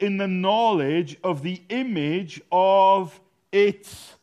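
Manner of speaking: slow, loud, weighty declaration; emphatic preaching. Real, not acted. Preacher telling congregation who they are, emphatic preaching. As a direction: drive each phrase home to the congregation.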